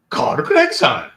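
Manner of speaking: slow, loud, delighted name call; excited recognition. Retold or imitated. Japanese crewman recognizing him.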